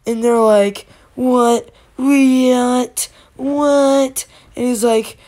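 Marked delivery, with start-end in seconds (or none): 1.2-4.2 loud, drawn-out disbelief; a chorus of baffled whats, each one stretched; stunned bank customers, hammed up; incredulous. Bank crowd reacts to robber's demand.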